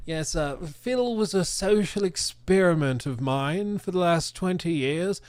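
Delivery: put-on a fairly light posh British accent. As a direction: play the smug English mastermind in a posh British accent. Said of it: "thick, posh British accent".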